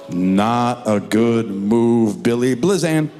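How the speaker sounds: slow dramatic trailer voice; slow, low movie-trailer narrator; ominous announcer. Acted.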